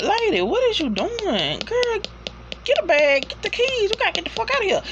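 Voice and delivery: high, exasperated plea to get going; urging her to flee. Retold or imitated. Viewer begging the lady on screen to run, high and urgent. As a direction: yell at her in a high voice to grab the keys. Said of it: "And I'm like".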